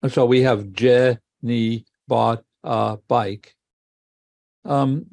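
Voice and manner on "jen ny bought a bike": slow, split into separate syllables; each syllable on its own, with gaps. Shown as a demonstration. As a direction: say it in pieces: je, ni, bought, a, bike. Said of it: "the division into syllables".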